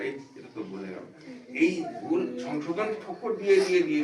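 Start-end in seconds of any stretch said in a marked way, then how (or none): 0.0-1.1 a much lower, much softer aside pointing out the mistake; hushed correction. Retold or imitated. A devotee quietly corrects a neighbour's prayer, hushed and low. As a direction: murmur the correction so the prayer isn't disturbed. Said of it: "ইশারা করে".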